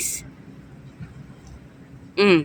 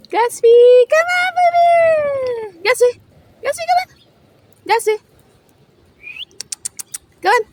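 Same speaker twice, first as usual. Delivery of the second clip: calling a dog; 7 semitones higher than usual. Real, not acted.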